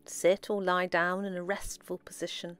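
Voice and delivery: soft, unhurried meditation voice with long pauses; guided meditation. Acted. Guide leading listeners into relaxed breathing, soft meditation voice. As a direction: guide them gently inward, softly, phrase by phrase.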